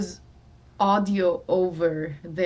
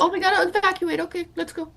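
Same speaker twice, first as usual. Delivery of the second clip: higher, breezy mock nonchalance; mock casual VIP; 9 semitones higher than usual. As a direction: toss it off like a rich guest who doesn't care. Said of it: "It's like".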